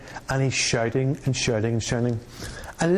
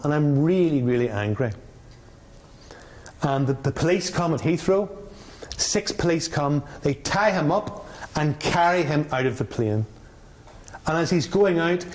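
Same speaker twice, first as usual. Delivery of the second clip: ordinary delivery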